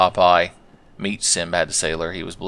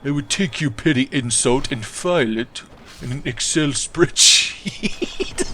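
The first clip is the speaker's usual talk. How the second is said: slow, prim nerd voice, pitched up; 4 semitones higher, slower than usual. Acted. Nerdy viewer filing away a petty insult, prim put-on voice. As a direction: answer as a pedantic nerd, drawing out the last word. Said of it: "The stereotypical nerds use Excel spreadsheets".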